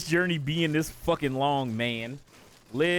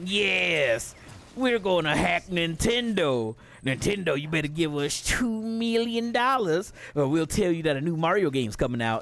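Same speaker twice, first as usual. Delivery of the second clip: mock hacker villain. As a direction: imitate a self-important hacker making demands. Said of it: "they come on there to do their dumbass videos with their fucking mask on".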